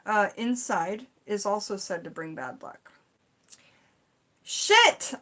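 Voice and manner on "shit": sudden high, loud blurt of dismay; startled curse. Real, not acted. Viewer learns she burned cedar inside, startled outburst. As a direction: yelp it as the realisation hits.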